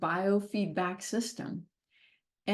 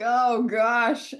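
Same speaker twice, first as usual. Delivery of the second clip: dismayed groan; 3 semitones higher, 7 dB louder than usual. Retold or imitated. Dismayed at the test result.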